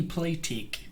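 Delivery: Kiwi accent with a broad E in "play tick". Shown as a demonstration. Fan showing off the Kiwi accent they like, broad E in "play tick". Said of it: "Like with a broad E".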